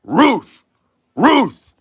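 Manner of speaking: high, loud barked name; dog bark. Acted. Dog answers the baseball question, barking. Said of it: "the dog looked back and said".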